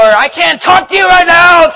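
high, loud snapping shout; angry dad shouting at his kids; retold angry yell. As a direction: yell it as the temper breaks. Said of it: "just something snaps and I just yelled at him".

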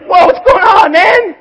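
high, loud freaked-out cry; startled shout. Pretend. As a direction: yell as if spooked by the voice. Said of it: "you're like".

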